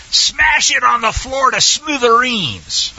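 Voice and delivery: high, slow, drawn-out "smash" and "smithereens"; very emphatic. Shown as a demonstration. Teacher showing the intensified verb form, very emphatic. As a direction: hit "smash" hard and stretch it. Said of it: "it basically is very emphatic".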